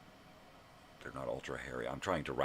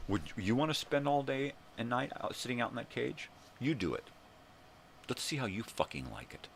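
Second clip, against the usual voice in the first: indignant challenge; 9 semitones higher than usual. Acted. Scolding the neighbor as if she stood there, indignant challenge. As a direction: dare her to try it herself.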